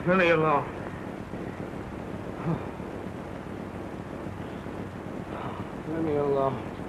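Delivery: giving up, words dying away; fading despair. Acted. Repeated, fading, drunk and beaten.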